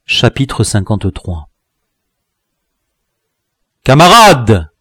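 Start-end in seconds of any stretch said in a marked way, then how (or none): 3.8-4.7 loud, higher commanding call; thundering voice. A narrator's reading. Chief hailing his men from above, thundering shout. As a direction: thunder it out to the crowd. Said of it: "cria une voix tonnante".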